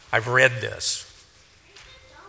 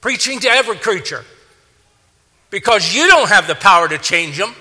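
raised, high-pitched exhortation; forceful preaching; 7 semitones higher, 5 dB louder, faster than usual. Real, not acted. Preacher telling believers they cannot change people, forceful preaching. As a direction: drive the point home like a fired-up preacher.

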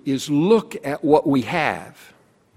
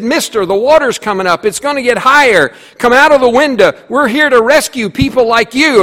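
raised, higher calling voice; rescuers calling out; 7 semitones higher, 4 dB louder than usual. Acted. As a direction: call out to him from the boat.

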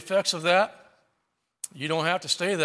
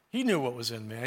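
soft echo; 6 semitones lower, 5 dB quieter than usual. Acted. Preacher repeats the truth to the church, hushed and low. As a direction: lower and softer, letting the line sink in. Repeated line, echoed softly.

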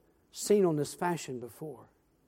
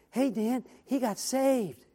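tearful quoted wife; 6 semitones higher, much slower than usual. Retold or imitated. Wife emerging after crying.

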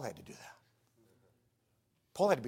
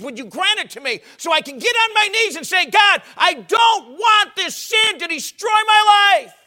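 loud, rising plea building to a shout; impassioned preaching; 9 semitones higher, 17 dB louder than usual. Real, not acted. Preacher begging God to grant him repentance, building to a shout. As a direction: cry out to God, building to full force.

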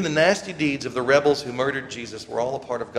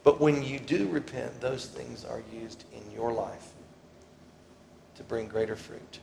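ordinary talk, nothing marked

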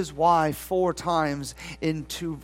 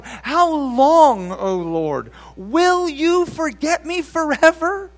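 accusing complaint; 8 semitones higher, 7 dB louder, slower than usual. Acted. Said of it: "He says in verse one".

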